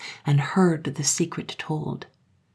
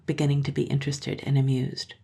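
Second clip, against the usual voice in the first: plain reading voice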